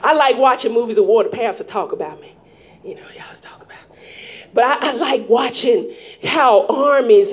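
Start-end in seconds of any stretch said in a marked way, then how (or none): 1.2-4.0 dropping to a whisper; hushed aside. Real, not acted. Preacher teasing about the pastor, whispered aside. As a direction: confide it under the breath. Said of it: "aside joke".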